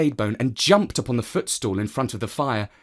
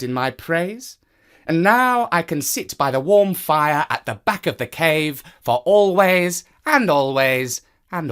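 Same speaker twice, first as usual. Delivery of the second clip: the smug cat claiming his place; 4 semitones higher, 3 dB louder than usual. Acted. Cat voiced in the story.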